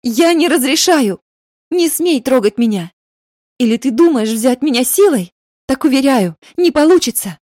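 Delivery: high, loud furious refusal; angry tirade. Acted. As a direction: shout him down in outrage. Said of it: "Её гневной тирады".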